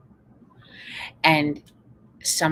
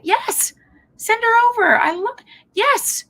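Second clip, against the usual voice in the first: higher, louder eager welcome, slowing at the end; eager yes; 9 dB louder, slower than usual. Retold or imitated. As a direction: say yes warmly and gladly. Coach eagerly agrees to parent.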